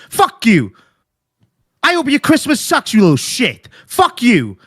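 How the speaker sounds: hard, falling insults in a real rant; angry tirade. Real, not acted. Furious man cursing out someone pleading a rough day, contemptuous. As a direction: spit each curse with contempt.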